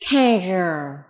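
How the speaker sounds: "casual" with a buzzing zh in the middle; zh sound. Shown as a demonstration. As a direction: model "casual" carefully, voiced zh for the s. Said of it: "Âm này là âm".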